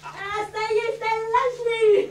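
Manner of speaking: tipsy wail, high and loud; drunk crying. Acted. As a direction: cry out like a drunk woman. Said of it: "elle est complètement bourrée". (pleurs d'ivrogne)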